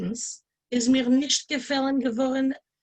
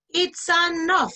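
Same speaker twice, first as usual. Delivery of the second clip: high, louder, slow it's enough; fed up; 7 semitones higher, 4 dB louder, slower than usual. Retold or imitated. Narrator tells the cashier he's had enough. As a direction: put your foot down.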